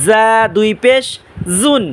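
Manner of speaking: "জা" and "জুন" buzzed like a bee's z; drawn-out "জা" with a sharp, buzzing z. Shown as a demonstration. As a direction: buzz the z at the start of "জুন". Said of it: "মৌমাছির আওয়াজের ন্যায় আওয়াজ".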